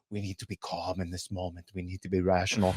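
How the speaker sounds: soft, mock-soothing pundit voice; quietly parroting a radio pundit; mocking calm pundit. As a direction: imitate a pundit urging everyone to stay calm. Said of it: "along the lines of".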